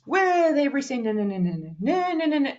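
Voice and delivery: "with every sentence" starts high and slides steadily down, then "no, no, no" repeats the tune; repetitive singsong pattern. Shown as a demonstration. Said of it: "voice always does this".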